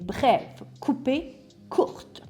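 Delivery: "brève", "coupée", "courte" each cut short; hard, brief hammer-blow words; masculine accentuation. Shown as a demonstration. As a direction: hit each word briefly, with a gap after. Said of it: "précise et dure comme un coup de marteau".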